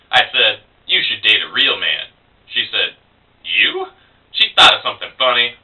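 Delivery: spoken-word poem with punched turning words; comic storytelling verse, paused between phrases; poetry reading. Acted. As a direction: perform the poem in measured phrases with lively pitch swings. Performs own poem.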